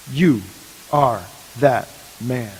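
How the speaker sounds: slow, weighty verdict, low and deliberate; grave accusation. Retold or imitated. Prophet Nathan to King David, slow and grave. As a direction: let each word land like a judgment. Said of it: "says these words".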